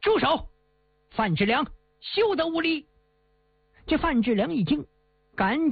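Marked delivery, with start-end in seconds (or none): 0.0-2.9 sharp commanding shout, higher; shout to stop. Acted. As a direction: hero barks at the villain to stop. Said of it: "朱元璋大喊一声". (高声喝止)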